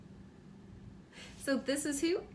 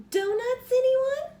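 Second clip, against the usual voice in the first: put-on much higher, louder sing-song girl's voice; sing-song offer; 11 semitones higher, 9 dB louder, slower than usual. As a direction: offer the donuts brightly to the family. Imogene offers donuts.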